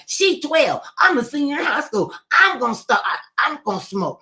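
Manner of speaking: put-on high, loud bratty teenage protest; defiant teen. Teen daughter tells her mother she'll smoke, high loud defiance. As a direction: announce it like a rebellious teen daring mama.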